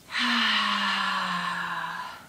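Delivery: long open-mouthed sigh; loud sigh. Shown as a demonstration. Sighing out loud as the teacher asks for noise. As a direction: let the breath out with a loud sigh. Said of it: "make some noise".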